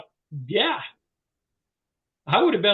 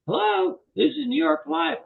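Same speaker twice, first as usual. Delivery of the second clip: a higher, lightly voiced phone receptionist greeting; 9 semitones higher than usual. Trainer plays New York Life receptionist picking up the call, lightly voiced. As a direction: answer brightly as the insurance company's front desk.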